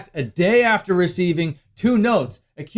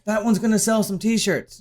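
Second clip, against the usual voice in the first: ordinary talk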